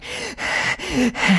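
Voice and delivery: heavy panting as if running; acted running breaths. Shown as a demonstration. Actor demonstrating how she voices running in a game, panting. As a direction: puff and pant on the spot, faking a run. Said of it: "start going".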